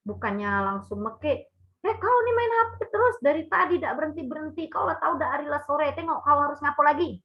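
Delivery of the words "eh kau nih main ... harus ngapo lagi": high, fast nagging scold in a regional accent; scolding mother. Shown as a demonstration. Teacher demonstrating how a mother wrongly scolds a child glued to the phone. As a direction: nag the child sharply, much higher and quicker. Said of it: "itu kan berbeda".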